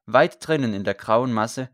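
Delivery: ordinary delivery, nothing marked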